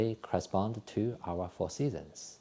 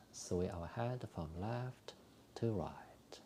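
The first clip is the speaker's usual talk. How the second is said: soft, calm breathing cues with whispered exhales; guided exercise instructions; 7 dB quieter, slower than usual. Acted. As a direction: talk the class gently through each movement. Leads a qigong breathing routine.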